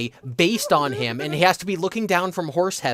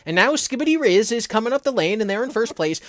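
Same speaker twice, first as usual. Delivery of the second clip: put-on old-timey radio race caller, higher; mid-Atlantic announcer; 8 semitones higher, 4 dB louder than usual. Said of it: "old-timey mid-Atlantic accent radio announcer".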